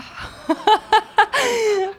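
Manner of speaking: high, loud burst of laughter. Real, not acted. Actress asked if she is tough, loud high laugh. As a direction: laugh out loud before answering.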